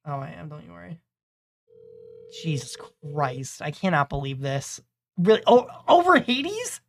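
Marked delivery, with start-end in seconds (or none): 5.2-6.8 much higher, slow, stunned; indignant disbelief. Real, not acted. Reacting to a friend's betraying vote with stunned disbelief. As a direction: drag out the outrage in disbelief.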